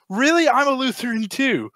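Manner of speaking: delighted surprise. Acted. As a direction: exclaim with joke-teller's mock excitement. Joke character discovers shared denomination.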